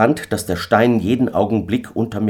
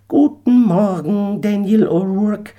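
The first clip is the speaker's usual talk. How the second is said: much higher, slow sly farewell; mocking eagle; 4 dB louder than usual. Eagle bids stranded Daniel good morning, high and sly. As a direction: tease him as you leave.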